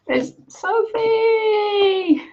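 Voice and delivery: high, slow drawn-out reveal; drawn-out announcement. Acted. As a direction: stretch the name out grandly. Announcing the winner again.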